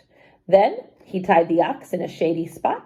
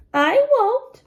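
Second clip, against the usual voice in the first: much higher earnest promise; promising. Acted. Wife promising husband to keep the secret, earnest promise. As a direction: give your word eagerly. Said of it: "she promised".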